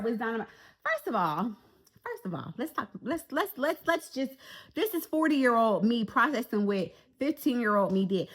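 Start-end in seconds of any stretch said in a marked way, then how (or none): none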